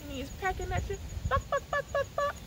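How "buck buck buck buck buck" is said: high clucks, short and quick; chicken clucking. Pretend. Librarian clucking like a chicken for the kids. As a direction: cluck like a little hen pecking.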